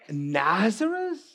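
high, drawn-out questioning of the name; incredulous disdain. Acted. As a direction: stretch it out in mock disbelief. Acting Nathanael's disdain for the town.

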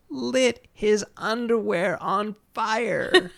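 high, slow stretch on "lit his underwear on fire"; drawn-out emphatic punchline. Real, not acted. Dramatic punchline about scolding columnist.

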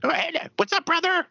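put-on squeaky, very high kid doing a wrestler; high prepubescent voice. Fourteen-year-old voicing wrestling figures, high-pitched mock wrestler. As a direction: mimic a boy whose voice hasn't broken. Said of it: "I haven't even hit puberty".

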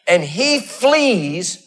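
building sermon cadence, rising and pushing; preaching. Acted. Pastor preaching to his congregation, rising fervor. Preacher telling Elijah story.

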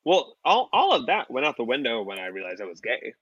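ordinary talk

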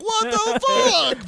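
very high, slow squeal of disbelief; mock shocked. Imagining Ben's reaction, in a very high mock squeal. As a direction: squeak it like a cartoon.

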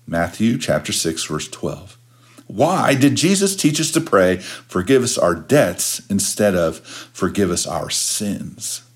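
normal talking voice

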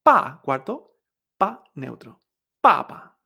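"pa cuarto" falling sharply, "pa neutro" light and short, then "papa"; teacher demo of papa tones, syllable by syllable; fourth then neutral. Shown as a demonstration. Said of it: "Fíjate".

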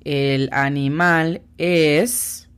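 "El animal es" drawn out slowly; slow example. Shown as a demonstration. Teacher illustrating a sentence for students, very slowly. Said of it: "puedo decir".

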